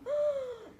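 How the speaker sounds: high, loud theatrical gasp; sharp gasp. Acted. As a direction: gasp in mock shock. Gasp as elf vanishes.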